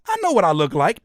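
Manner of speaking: ordinary delivery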